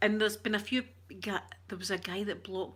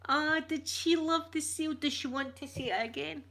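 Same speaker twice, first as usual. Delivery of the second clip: high, louder cooing voice; playful cooing; 8 semitones higher, 3 dB louder than usual. Pretend. Streamer teasing a viewer about the seal, playful cooing. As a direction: coo as if to a little one.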